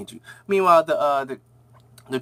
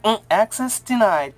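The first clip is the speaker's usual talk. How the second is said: slow, higher robotic scanner voice; machine voice; 6 semitones higher than usual. Retold or imitated. Door scanner refusing Dick Grayson, robotic. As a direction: read it out like a flat security system.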